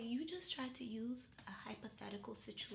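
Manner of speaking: very soft, near-whispered retold reproach; hushed rebuke. Date calling out her excuse, hushed rebuke. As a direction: say it low-key and hushed, unimpressed.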